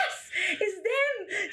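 high, slow, laughing shriek of relief; a giddy, laughing cry of relief; excited scream. Retold or imitated. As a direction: squeal it out as rescue arrives. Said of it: "So it starts screaming".

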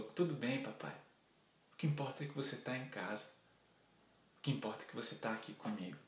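soft, low, gentle consolation; hushed, loving daughter, lightly acted; tender reassurance. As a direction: comfort daddy very softly. Younger daughter consoles father, hugging.

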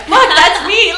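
high, loud shocked outburst; loud exclamation. Retold or imitated. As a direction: blurt it out loud. Acted shock at old tweet.

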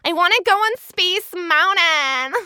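put-on high, loud Valley girl whine. Shown as a demonstration. Actor showing off the LA accent, high Valley girl voice. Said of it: "become a Valley girl".

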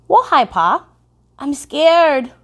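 a small girl voicing her fear; scared little girl. A narrator's reading. Little girl scared of the cartoon eyes, high and fearful. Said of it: "she said".

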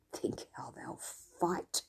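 slow, soft sly whisper; scheming landlord whispering to himself, gleeful; muttered. Acted. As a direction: savour it under your breath. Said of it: "he muttered".

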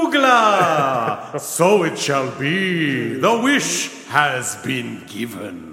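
slow, grand genie incantation. Pretend. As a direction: intone it like a mystical genie. Genie grants wish.

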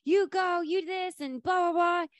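high-pitched mock yell at everybody; imitated yelling. Ross herding everybody out to the cabs, mock yell. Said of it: "Ross had yelled at everybody".

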